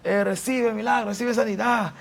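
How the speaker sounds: high-pitched mock preaching, drawn up into proclamation; imitating a preacher. Boy preaching alone into a microphone, imitated high-pitched preacher voice. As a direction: proclaim it like a child playing preacher. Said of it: "yo comenzaba a predicar solo".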